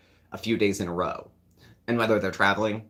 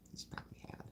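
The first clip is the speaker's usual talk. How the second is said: whispered repeat to herself; whispered aside; 25 dB quieter, faster than usual. Real, not acted. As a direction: breathe the words away. Muttered repeat.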